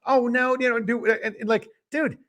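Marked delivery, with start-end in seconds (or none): 0.1-1.1 higher-pitched mock South Carolina accent; half-hearted South Carolina impression; attempted South Carolina accent. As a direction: imitate a flustered South Carolina politician. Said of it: "he's in that accent trying to".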